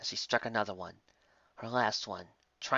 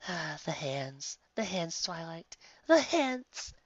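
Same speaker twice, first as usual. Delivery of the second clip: high, lovestruck rapture; dreamy swooning; 7 semitones higher, slower than usual. Acted. Lyra swooning to Twilight about human hands. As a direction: swoon over every word. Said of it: "sighed dreamily".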